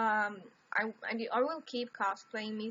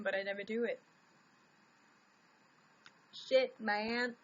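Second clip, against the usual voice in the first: ordinary talk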